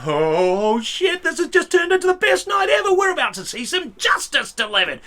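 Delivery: high, loud excited whisper-rush; can't contain the delight; a room gleefully watching; giddy glee. Retold or imitated. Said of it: "we all sat there going".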